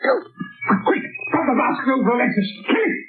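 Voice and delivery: frantic orders. Acted. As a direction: bark orders in a panic. Captain orders amid snake attack.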